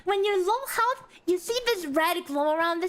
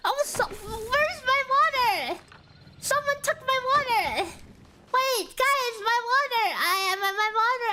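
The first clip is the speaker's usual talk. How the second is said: mock whining; 6 semitones higher than usual. Missing water, mock distress.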